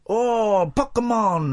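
hammed-up slow, high awed "Oh, Pokemon"; mock the inventor's wonder, drawn out; Pac-Man creator impression. Host imitating the Pac-Man inventor recalling his idea, mock awe. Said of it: "that is actually a brilliant impression".

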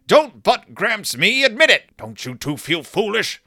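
wag a finger and scold them; lightly hammed-up cranky grandpa; scolding Gramps. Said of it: "he actually says that".

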